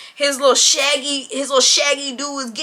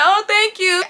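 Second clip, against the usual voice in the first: high polite thanks; 8 semitones higher, slower than usual. Retold or imitated. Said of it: "So I was like".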